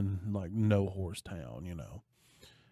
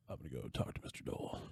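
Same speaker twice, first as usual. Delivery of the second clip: fast, breathless whisper, much softer and lower; hushed cool line; 9 semitones lower, 13 dB quieter, much faster than usual. Retold or imitated. Bruce tells the bar owner his plan, in a quick whisper. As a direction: throw it away in a cool whisper.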